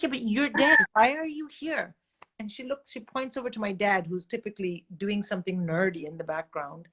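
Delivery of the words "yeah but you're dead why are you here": louder, higher protest; retold shouting. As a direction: insist in a raised voice at the mother. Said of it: "Oh, stop shouting".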